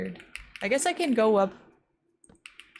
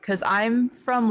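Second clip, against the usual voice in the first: ordinary delivery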